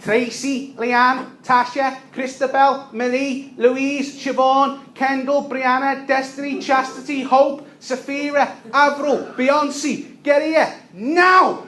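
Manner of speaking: high, loud yelling of names; screaming names. Retold or imitated. Mother screams her kids' names from the corner, high and loud. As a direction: bellow every name across the street. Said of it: "screaming at the top of her lungs".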